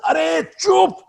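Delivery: high, sharp yell to silence them; sudden shout. Retold or imitated. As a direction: snap at everyone to shut up. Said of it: "He just yells at them".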